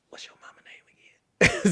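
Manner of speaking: whispered aside with the phone covered. Retold or imitated. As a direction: whisper it so the caller can't hear. Coach covers phone, asks quietly.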